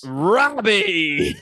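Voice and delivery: high, loud drawn-out yell of a name; mock calling out. Interrupting the classroom story to call out Robbie, mock yell. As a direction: holler the name in mock reproach.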